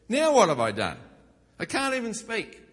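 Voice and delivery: put-on loud, wounded kid's outcry; hurt protest. Younger brother protesting loudly at his scolding brothers. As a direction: cry out like an unfairly blamed kid.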